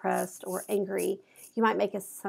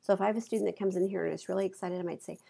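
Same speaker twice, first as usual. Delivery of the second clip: ordinary talk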